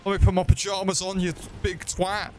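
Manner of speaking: high, panicky pleading; mock panic. Real, not acted. Gamer begging the boss for a moment, in high panic. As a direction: squeal for time to get pajamas on.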